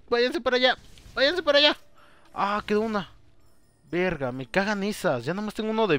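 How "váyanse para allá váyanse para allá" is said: shooing off enemies. Real, not acted. Shooing enemies away in game.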